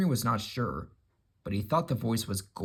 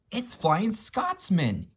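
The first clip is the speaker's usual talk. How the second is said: slow, much higher awed gasp; 10 semitones higher, 3 dB louder, much slower than usual. Acted. Gordon sees his brother arrive, awed gasp. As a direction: breathe the name in wonder. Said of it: "he gasped".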